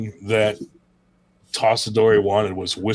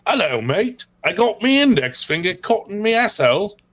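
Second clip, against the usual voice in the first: high put-on British bloke voice; mock British accent; 8 semitones higher than usual. Repeating the mock British accent for his co-host. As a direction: ham up a cockney lad. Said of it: "you've gotta say it like them".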